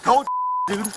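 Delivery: high, strained commands; shouted surrender demands. Real, not acted. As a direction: order him while wrestling. Officer pins suspect down.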